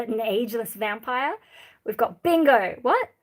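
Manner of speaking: usual speaking voice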